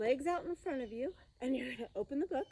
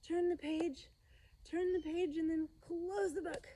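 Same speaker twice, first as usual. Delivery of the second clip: mock strain; 6 semitones higher than usual. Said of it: "This is where it gets real hard".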